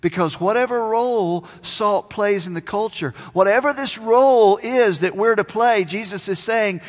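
usual speaking voice

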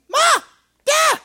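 put-on high loud baby shout of Da; little boy yelling for his dad; toddler calling out. Son calling from his crib, loud baby shout.

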